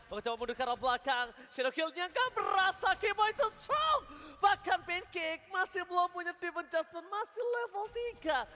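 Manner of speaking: rising to high, loud shouts; excited play-by-play. Real, not acted. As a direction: build up to a hype peak. Caster hypes a fight.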